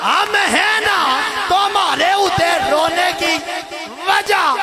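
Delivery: high, loud preaching at full force; a preacher carried away by the story, calling out loud; fiery preaching. Acted. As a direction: preach it with rising passion. Preaching climax, story voices.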